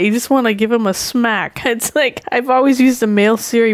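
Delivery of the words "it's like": ordinary talk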